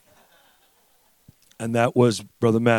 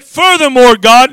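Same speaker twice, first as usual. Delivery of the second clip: loud, high, insistent address; screaming plea; 11 semitones higher, 13 dB louder than usual. Retold or imitated. Father re-enacting his two o'clock prayer, screaming plea. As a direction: call God to account at raised volume. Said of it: "I'm screaming and crying".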